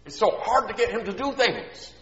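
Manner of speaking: sigh, then high-pitched whiny complaint; exasperated mom. Acted. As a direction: act out a put-upon mother's lament. Mom's complaint acted out.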